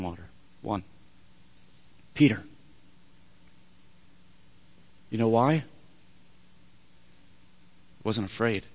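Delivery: low, soft, weighty delivery; quiet, deliberate revelation; solemn hush. Real, not acted. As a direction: drop your voice and let the pauses land. Preacher drops low for the point.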